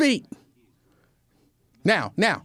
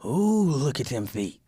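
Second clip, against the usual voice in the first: lightly voiced admiring men, lower; men ogling feet; 4 semitones lower, 3 dB quieter than usual. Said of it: "The men would be watching like".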